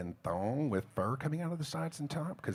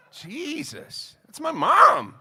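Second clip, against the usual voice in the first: high exasperation bursting into a loud yell; hammed-up indignant cry; mock outrage; 14 semitones higher, 11 dB louder, much slower than usual. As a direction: protest high, then shout the last words. Mock outrage defending mom.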